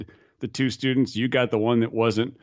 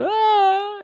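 very high, loud wail; imitated panic cry; 5 dB louder than usual. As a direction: mimic a terrified skydiver's long, high "aah". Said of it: "you know, that sound".

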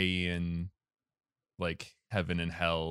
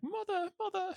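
put-on high childish British girl calling mother; squeaky little girl calling for mom; 6 dB quieter than usual. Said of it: "She has a little British accent".

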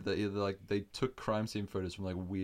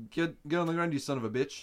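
loud, raised order; mock police command; 6 semitones higher, 5 dB louder than usual. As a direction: order him down like a raiding agent. Acting out agents storming in.

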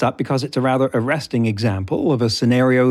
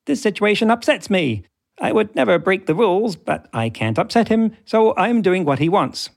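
high squeaky chipmunk robot; 8 semitones higher than usual. Retold or imitated. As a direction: pitch it way up like a chipmunk. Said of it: "[name]'s voice is like Alvin and the Chipmunks".

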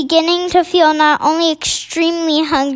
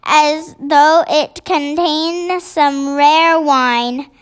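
plain reading voice

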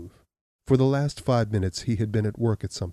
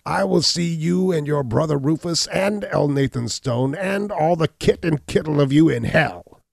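much higher and louder curse; raging cry; 8 semitones higher, 6 dB louder than usual. Acted. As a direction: scream damnation at them all. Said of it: "cried the hunchback".

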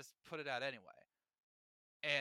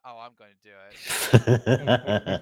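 slow, loud, laughing threat; relishing, laughing promise; laughing glee; 6 semitones lower, 19 dB louder, much slower than usual. Real, not acted. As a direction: savour the rant with a laugh. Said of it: "something to rant about".

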